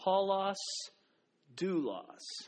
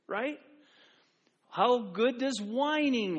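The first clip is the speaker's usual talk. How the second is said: usual speaking voice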